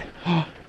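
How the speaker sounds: sharp in-breath of wonder; a quick gasp of surprise. Real, not acted. Stepping into the mine for viewers, sharp gasp.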